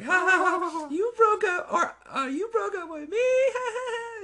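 squealing taunt laugh; mocking laughter. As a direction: cackle it out high and taunting. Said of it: "she's laughing".

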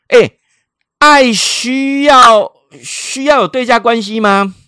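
high, loud, first words drawn out; outraged challenge. Retold or imitated. Stranger challenges a mother about loving her child, outraged. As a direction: throw the question back in loud disbelief.

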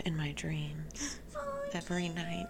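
hushed, sighing mock longing; put-on wistfulness. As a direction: whisper it slowly, as if pining. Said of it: "You don't have to act like that".

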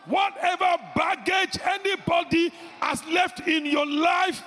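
loud, high commanding decree; preaching at full force. Acted. Preacher commanding baggage out in Jesus' name, shouting. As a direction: thunder it over the congregation.

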